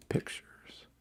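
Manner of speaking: whispering. Real, not acted. Whispered aside.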